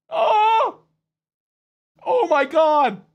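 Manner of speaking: high, loud gasp of delight; surprised yelp. Real, not acted. Streamer suddenly delighted by the chat, surprised. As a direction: react like you just spotted something amazing.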